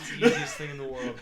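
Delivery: quick, laughing delivery. Real, not acted. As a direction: rush it out through laughter. Joking about riding bareback.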